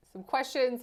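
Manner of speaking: ordinary talk, nothing marked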